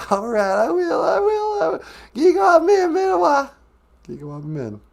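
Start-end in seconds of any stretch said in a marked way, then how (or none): 0.1-1.8 very high puppet-like voice; high-voiced character. Character promising the host, high voice. 2.1-3.5 loud, very high puppet-like farewell; high-voiced character. Character saying goodbye to the host, high loud voice.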